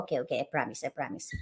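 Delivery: low, hushed, meekly giving in; meek promise. Retold or imitated. Mom promising her kids not to curse, hushed and meek. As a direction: murmur the promise low and soft like a scolded kid. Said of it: "Sabi ko".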